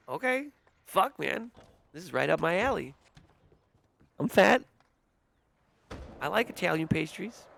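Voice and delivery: high-pitched comic quote; hammed-up user voice. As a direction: play an over-keen dessert fan, pitched high. Acting out a user's excited message.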